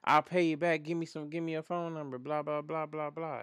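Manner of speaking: put-on high pestering voice; mock pleading. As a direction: run on like someone begging, dismissively. Mimics her pleading and pestering.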